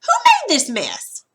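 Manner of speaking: high, loud demanding question; questioning demo. Shown as a demonstration. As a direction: ask it like you want an answer. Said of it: "is interrogative".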